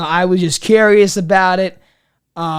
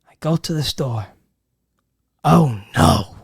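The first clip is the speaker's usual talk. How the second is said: mock dread; 8 semitones lower, 4 dB quieter than usual. Dramatized dread.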